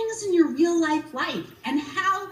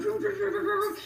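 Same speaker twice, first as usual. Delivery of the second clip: mouthed printer whirring sounds; playful imitated printer noise; 3 semitones higher, faster than usual. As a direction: imitate a busy printer churning out pages. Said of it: "our printer ... just started going".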